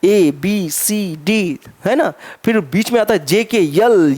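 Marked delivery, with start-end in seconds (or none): 0.0-1.6 slow sing-song recital of A B C D; teacher imitating a child reading the alphabet slowly; child reciting alphabet. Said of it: "बड़ा प्यार से पढ़ता है".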